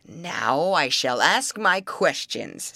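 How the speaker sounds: slow, loud troll voice. Acted. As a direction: growl it out like an old troll.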